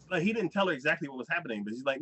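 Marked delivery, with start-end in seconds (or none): none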